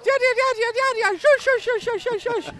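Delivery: rapid-fire high-pitched Russian skip shrieks; Anna Sidorova. Retold or imitated. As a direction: yell repeated sweeping calls in a caricatured screech. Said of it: "I see Anna Sidorova".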